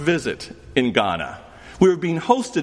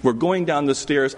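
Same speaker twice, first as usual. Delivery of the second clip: ordinary talk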